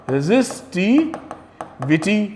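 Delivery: "resistivity" drawn out in slow, separate parts; slow dictation. Shown as a demonstration. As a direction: stretch out "resistivity" for note-takers, pausing before the last part. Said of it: "ये क्या होता है".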